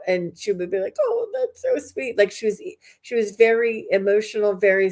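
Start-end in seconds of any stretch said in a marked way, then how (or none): 1.0-2.2 teary grandma. Retold or imitated. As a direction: gush like a teary-eyed grandma. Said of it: "start tearing up".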